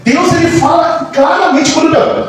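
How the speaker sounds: high, emphatic sermon delivery; impassioned preaching. Real, not acted. Preacher assures the congregation God speaks clearly, impassioned preaching. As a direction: preach it with building urgency.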